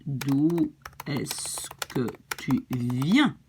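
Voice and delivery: very slow, each word separated; slow teaching model. Shown as a demonstration. Teacher gives learners the other version of the question, very slowly. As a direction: stretch out every word for a beginner.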